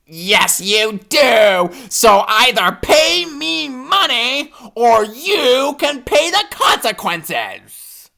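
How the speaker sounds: high, slow insistent threat; glaring badger. A narrator's reading. Badger glaring at hippo, high slow threat. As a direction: lean into the threat. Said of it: "The honey badger glares".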